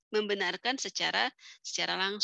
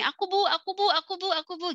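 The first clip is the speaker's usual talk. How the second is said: put-on high eager child's voice, pleading to be picked; eager kids calling out; 10 semitones higher, 4 dB louder than usual. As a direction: play a kid begging the teacher to choose him. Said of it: "gitu. Biasanya gitu ya anak-anak".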